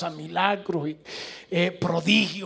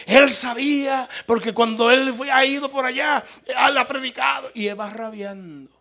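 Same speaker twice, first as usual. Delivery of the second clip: fuming; 4 semitones higher, 8 dB louder than usual. Retold or imitated. Angry traveler complaining about the pastor, fuming. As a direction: retell it sparking with outrage. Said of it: "echando chispa".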